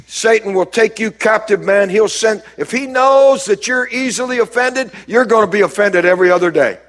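ordinary talk

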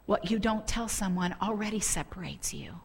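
soft, solemn whisper; hushed revelation. Retold or imitated. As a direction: whisper it as a word heard in the spirit. Inner voice of the spirit retold.

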